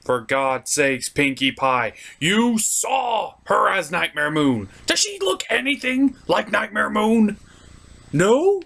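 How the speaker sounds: normal talking voice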